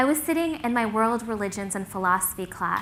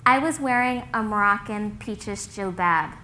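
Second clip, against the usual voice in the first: usual speaking voice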